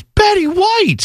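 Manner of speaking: high, slow and stunned, breaking sad news; dismayed wife. Retold or imitated. Wife reading her phone, slow and dismayed. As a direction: draw the name out in shock. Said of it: "She goes".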